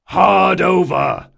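slow, loud, high bellow; yelled order. A narrator's reading. Captain to sailing master, yelled command. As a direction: yell the helm order with urgency. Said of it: "he yelled again".

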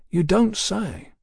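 lightly grunted, unhurried reaction; grunted reply. A narrator's reading. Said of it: "the king grunted".

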